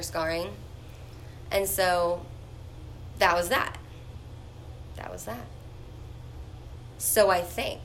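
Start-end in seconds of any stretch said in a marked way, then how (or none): 5.0-5.5 much softer, repeated under her breath; quiet echo. Real, not acted. Echoing herself after the story ends, much softer. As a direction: murmur it to yourself as an afterthought. Muttered echo of own line.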